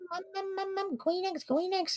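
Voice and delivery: put-on very high weird baby voice. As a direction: squeak it like a toddler. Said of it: "like, a weird".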